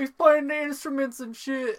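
high, loud mock cheering parent; proud dad cheer. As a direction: play along as the proud dad. Joins the dad imitation.

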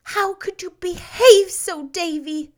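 high-pitched, sorrowful question; sorrowful reproach. A narrator's reading. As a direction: ask it in pained disappointment. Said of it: "she asked sorrowfully".

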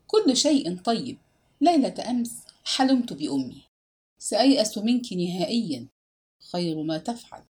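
usual speaking voice

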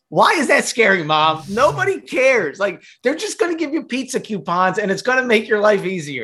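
high-pitched exasperation with mom; exasperated retort. Retold or imitated. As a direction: play up the exasperation, pitch jumping up.

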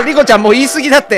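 high, loud, hurried interruption; alarmed, rapid telling-off; flustered protest. Acted. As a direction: rush in to stop her. Cuts in to stop the rant.

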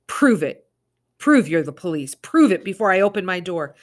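loud, firm challenge; steady and strong. Acted. Mother calling out firmly to the intruders. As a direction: stand your ground, make it carry. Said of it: "steady and strong as she could".

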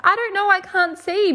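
high-pitched acted excitement; retold urgent exclamation. New mum answering the nurse about the gender, high and excited. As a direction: exclaim it high, re-enacting the moment.

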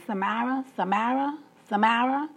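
Samara said as suh-MAIR-uh, rising each time; teacher's mispronunciation. Retold or imitated. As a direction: call Samara with a flat "a" like "mare", as if reading a roll. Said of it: "the substitute teacher kept saying".

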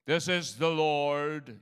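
slow, booming preacher voice; pulpit voice. Retold or imitated. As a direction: boom it out like an over-solemn preacher. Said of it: "He doesn't have a pulpit voice".

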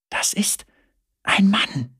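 breathy unvoiced whisper. A narrator's reading. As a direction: hush it out, barely voiced. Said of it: "raunte ich".

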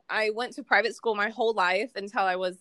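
ordinary delivery

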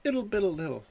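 jokey Trump nonsense noises; Trump babble mock. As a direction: squeak out the gibberish like Trump. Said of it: "I like when Trump does those little".

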